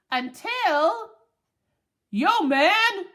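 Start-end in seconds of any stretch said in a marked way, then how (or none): 2.1-3.1 loud, high, pushy dog's call; barking demand. A narrator's reading. Dog Lance demands his human's attention, barking. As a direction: bark it out like a worked-up dog. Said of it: "Lance is barking".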